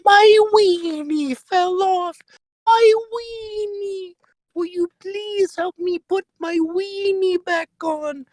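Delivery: put-on very high, slow falsetto old lady voice, wailing; whimpering old woman begging for help; old woman character. To the listener in the bathroom, a wailing falsetto granny. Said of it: "the old woman comes up to you and she goes".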